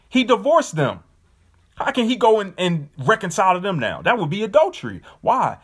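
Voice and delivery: a preacher building to the climax; fired-up preaching. Real, not acted. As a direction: raise it up and hammer home. Preacher building to his point.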